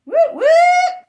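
high, loud cheering whoop; big celebratory whoop; excited whoop. Acted. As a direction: cheer it out at the top of your voice. Cheer ending the chant.

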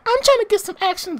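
high-pitched imitation of a girl; mock girl voice. Girl wants in on the fight, mock girlish voice. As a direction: put on a high female character voice.

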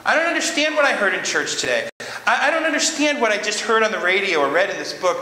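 high, pleading voice of a bewildered believer; a believer crying out to God, high and confused; confused prayer. Acted. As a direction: plead to God, lost and unsure, with a nervous laugh.

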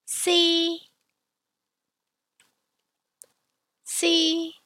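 Si held high and level; first tone. Shown as a demonstration. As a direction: sing Si high and flat at the top of the voice. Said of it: "so practice with me".

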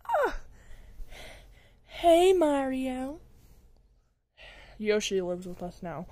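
ordinary talk, nothing marked

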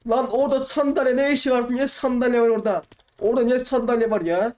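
high-pitched indignant complaint; exasperated outrage. Real, not acted. Player complaining about a chair in the way, exasperated.

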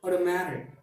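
automatic with the t tapped like a d, otomadic; flap T. Shown as a demonstration. Teacher modeling the American automatic with a flap. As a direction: tap the t in automatic quickly. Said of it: "we pronounce it like".

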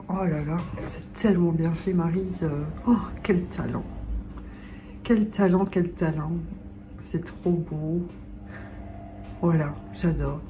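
quiet, hushed admiration; soft admiring. Real, not acted. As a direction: marvel softly at the painting. Admiring the painting softly.

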